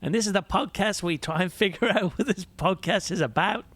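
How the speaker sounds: put-on high-pitched Aussie accent. Podcast host opens the intro in an Aussie accent. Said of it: "I'll do an Aussie accent".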